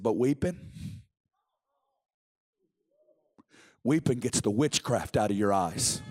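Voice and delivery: low, slow seer's pronouncement; hushed low-voiced revelation from an old prophet; Bob Jones. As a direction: drop low and weight each word. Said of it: "He said".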